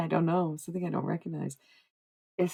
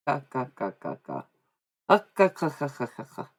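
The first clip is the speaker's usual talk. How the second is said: repeated staccato "ka" sounds, trailing lower; imitated "ka" noises; 4 semitones lower, 3 dB quieter than usual. Shown as a demonstration. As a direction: rattle off short throaty-style "ka" noises.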